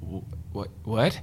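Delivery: soft stunned stammer, then a fuller "what?"; hushed mock disbelief; retold bafflement. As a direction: imitate a quiet double take. Editor's retold surprise at his accent.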